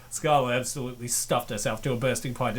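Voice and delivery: ordinary delivery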